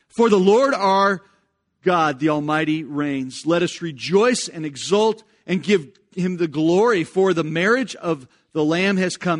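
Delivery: loud, high, proclaiming reading; ringing public reading of Revelation; fervent scripture reading. Acted. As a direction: read it like a herald from the pulpit. Reads Revelation aloud with force.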